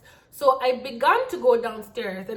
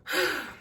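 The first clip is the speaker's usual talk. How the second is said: long audible breath out; sigh; 4 semitones higher than usual. Real, not acted. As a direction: let out a sigh. Sighs after exclaiming.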